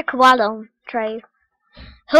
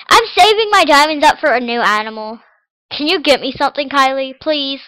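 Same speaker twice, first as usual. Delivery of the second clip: ordinary talk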